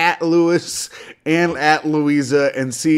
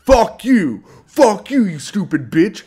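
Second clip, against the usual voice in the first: mock angry rant; 4 semitones higher than usual. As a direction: play the furious co-host, hammed-up. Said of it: "And you were like".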